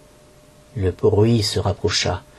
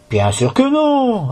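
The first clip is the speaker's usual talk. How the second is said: broad smile; 13 semitones higher, slower than usual. A narrator's reading. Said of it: "avec un large sourire".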